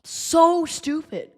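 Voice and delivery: slow exasperated so stupid; disgusted. Real, not acted. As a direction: stretch out the so in disgust. Fan disgusted at team.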